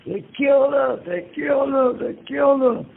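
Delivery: high-pitched mock scream without the volume; imitated teachers' screaming. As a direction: mimic a panicked cry, high and restrained. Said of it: "teachers started running around screaming".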